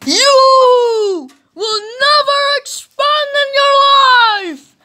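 very high, loud, slow howl; ghostly curse. Acted.

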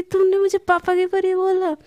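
acted miffed outburst; irritated. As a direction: play offended at the nickname. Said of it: "एकदम और चिढ़ जाती है".

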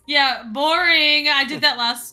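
loud, slow mock boredom; sarcastic brag. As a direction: drawl it like it's nothing. Joke about leveling a city.

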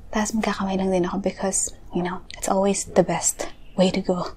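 fast ASMR whisper. Real, not acted. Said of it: "I'm just gonna be whispering".

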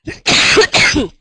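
sharp high real cough. Real, not acted. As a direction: cough from a sore throat. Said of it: "Scusate, sono un po' malata".

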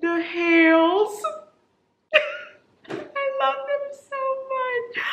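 high, slow, stretched-out squeal; overjoyed squeal. Real, not acted. Fangirl squealing slowly over the Hales. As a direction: melt into a long, high gush. Gushing over favorite characters.

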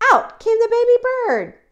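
high, loud burst of storytelling; thrilled storyteller's reveal; excited reveal. A narrator's reading. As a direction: announce the big arrival high and loud. Big moment of the hatching.

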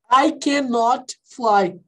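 slow, careful I cannot fly; slow full form. Shown as a demonstration. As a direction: spell out every word of I cannot fly slowly. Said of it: "o not termina em T".

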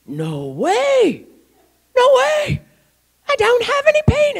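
high, loud, amazed exclamation; acted-out shout of disbelief; astonished disbelief. Healed cop to his partner, loud amazed disbelief.

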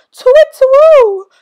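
high loud hoot of alarm; frightened owl hoot. Acted. Owl hoots in fright before fleeing.

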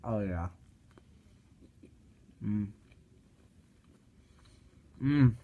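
chewing a bite; talking while chewing; mouth full. Real, not acted. Seen 1: bites wrap at 0.2, chews with closed mouth throughout.

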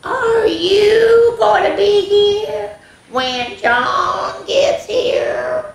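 very high, very slow screech; slow screechy cat. Acted. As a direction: stretch it out like an eerie cat. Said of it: "in a slow, screechy voice".

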